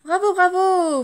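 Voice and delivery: high, loud bravo cheers; delighted applause shout; impressed crowd cheering. Acted. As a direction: cheer like an amazed audience. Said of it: "Fait le public impressionné".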